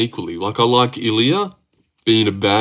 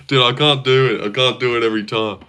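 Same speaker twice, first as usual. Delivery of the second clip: ordinary delivery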